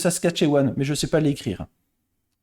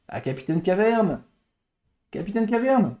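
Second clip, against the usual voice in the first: usual speaking voice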